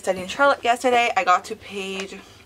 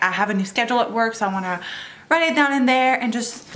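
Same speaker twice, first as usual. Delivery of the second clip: ordinary talk, nothing marked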